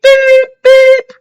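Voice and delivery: high loud beep beep; imitated beeping. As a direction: toot it out like a horn. Imitating a horn or beeper.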